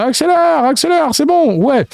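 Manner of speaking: high urgent yell; frantic high shout. A narrator's reading. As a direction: yell at the driver to floor it. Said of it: "cria sur Ron".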